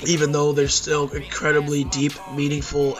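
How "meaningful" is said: normal talking voice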